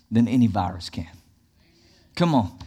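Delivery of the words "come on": normal talking voice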